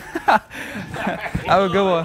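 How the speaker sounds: laughing through a goodbye; drawn-out laughing farewell. Real, not acted.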